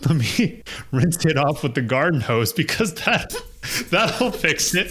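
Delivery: laughing through a friend's silly fix; laughing friend quote. Retold or imitated. Laughing while quoting the friend's garden hose idea. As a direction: giggle it out like a kid with a bad idea. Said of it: "my friend was like".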